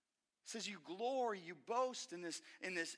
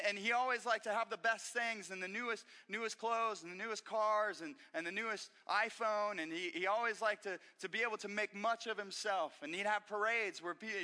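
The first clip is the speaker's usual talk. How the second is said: ordinary talk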